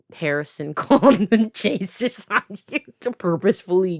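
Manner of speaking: real laughter breaking into the words; laughing while talking. Real, not acted. Retelling Foster's apology about the chasers, talking through laughter. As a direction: crack up mid-sentence and keep talking.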